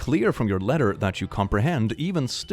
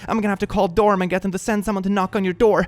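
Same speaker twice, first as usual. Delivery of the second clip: high, frantic worried mother; panicked mom voice; 10 semitones higher than usual. Retold or imitated. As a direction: read it like a panicking parent.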